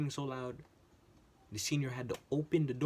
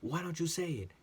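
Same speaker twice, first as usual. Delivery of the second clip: higher, louder mock yell at a coworker; retold screaming; 8 semitones higher, 4 dB louder than usual. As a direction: imitate her shouting in your face. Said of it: "Why are you screaming?".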